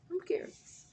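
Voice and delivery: light breathy whisper; whispered aside. Real, not acted. Vlogger slyly admitting curiosity about the men, whispered. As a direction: lean in and whisper it.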